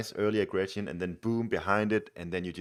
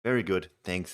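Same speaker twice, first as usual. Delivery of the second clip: ordinary talk, nothing marked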